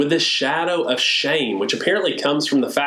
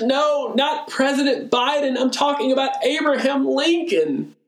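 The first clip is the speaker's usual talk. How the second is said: hammed-up teary protest, much higher; mock tearful correction; 11 semitones higher than usual. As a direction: pretend to blubber out the correction. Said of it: "I say through my tears".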